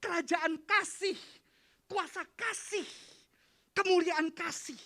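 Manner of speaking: high, loud sermon delivery; full-force preaching. Acted. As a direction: proclaim it loudly, drawing out the key words.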